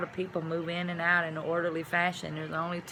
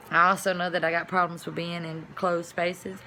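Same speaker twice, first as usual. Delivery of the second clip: ordinary delivery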